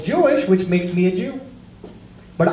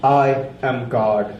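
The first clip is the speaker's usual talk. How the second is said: slow solemn God; 9 semitones lower, much slower than usual. Acted. God answers slowly.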